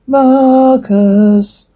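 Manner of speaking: high, drawn-out demon call; demonic voice. Acted. Demon interrupting the priest's prayer, demonic voice. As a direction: hold the name high and eerie. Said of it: "Demonic voice".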